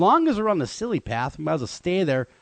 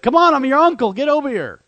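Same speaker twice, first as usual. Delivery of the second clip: higher and louder, coaxing; mock coaxing call; 9 semitones higher, 7 dB louder than usual. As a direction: call the monkey over like a fake uncle. Imagined captor coaxing monkey.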